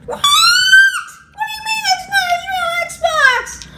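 put-on very high-pitched upset kid voice; high squeaky voice. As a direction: whine it way up high. [name]'s put-on voice.

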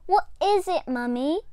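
put-on bright, eager little-girl question; excited child. A narrator's reading. Little Diane eagerly asking her mum. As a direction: lean in, keen to know. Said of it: "asked excitedly".

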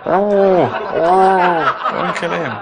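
hammed-up frightened plea through laughter; mock pleading. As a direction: beg for a friend's life, half-laughing. Victim pleading before Pol Pot.

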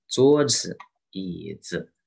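桌子, 椅子 with stressed first syllable and light 子; neutral-tone demo. Shown as a demonstration. Teacher reads examples, 子 in 桌子 and 椅子 in neutral tone. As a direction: lean on 桌 and 椅, drop 子. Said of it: "有三种neutral tone啊".

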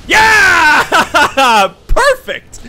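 high, loud cheer breaking into laughter; jubilant, laughing celebration; triumphant shout. Real, not acted. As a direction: yell in victory, then laugh. Celebrates winning the fight.

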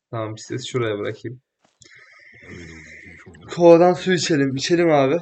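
ordinary talk

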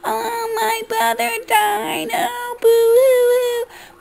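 very high, whiny fake sobbing, boo hoo; mock the brother's crocodile tears; mocking fake crying. Vlogger mocking the brother's public grief, hammed-up fake sobbing. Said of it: "your fake-ass motherfucking".

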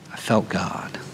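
a soft, low-voiced I felt God; hushed awe. Man telling the church he finally felt God, in hushed awe. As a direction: let it drop into quiet wonder.

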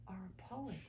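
whispered in awe; hushed admiration; awed hush. Acted. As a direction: breathe it in wonder. Mice in awe.

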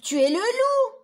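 put-on high, loud child's shout; little girl in red calling out to the wolf; little girl's cry. A narrator's reading. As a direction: shriek it like a startled little girl. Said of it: "cria la petite fille".

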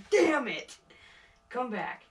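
ordinary talk, nothing marked

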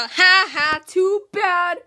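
loud, high taunt; loud gloating. Real, not acted. Player to a knocked-out rival, loud gloating. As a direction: crow over a beaten rival.

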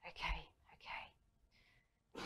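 very slow whispered okays; slow calming whisper. Retold or imitated. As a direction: breathe them out slowly. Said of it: "counting breaths".

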